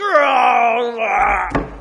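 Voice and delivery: loud wordless cry of frustration; frustrated cry. Retold or imitated. Daughter to her father who missed her point, loud frustrated cry. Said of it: "it was something like".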